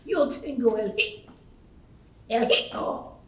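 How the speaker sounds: hiccups breaking the word; stuck on the word with hiccups. Acted. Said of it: "I have the hic".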